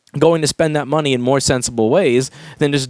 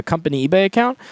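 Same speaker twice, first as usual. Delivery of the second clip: normal talking voice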